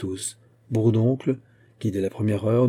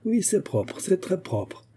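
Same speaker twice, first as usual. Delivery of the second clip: high, sarcastic scolding without raising the volume; indignant inspector rubbing it in; indignant, trembling lips; 9 semitones higher, slower than usual. A narrator's reading. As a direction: pitch up in outraged mock approval. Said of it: "dit-il, les lèvres tremblantes".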